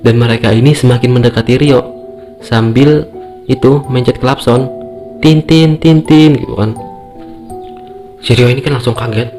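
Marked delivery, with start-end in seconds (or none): 5.2-6.4 high honking tin tin; horn beeps. Retold or imitated. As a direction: beep like a motorbike horn. Imitating a motorbike horn.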